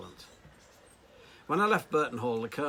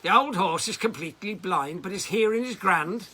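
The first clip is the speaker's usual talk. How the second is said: put-on higher, louder Irish accent full of disgust; disgusted Irish farmer; 8 semitones higher, 8 dB louder than usual. Irish farmer scolding the jockey with disgust, Irish accent. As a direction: scorn him in a broad Irish voice. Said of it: "looked at me with disgust and said".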